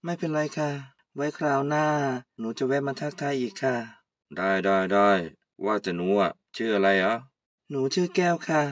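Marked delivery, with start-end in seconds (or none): 4.4-7.1 put-on much lower, easygoing old man greeting; old uncle. Old uncle greeting Kaew, much lower. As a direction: drop low like a relaxed old uncle. Uncle asks her name.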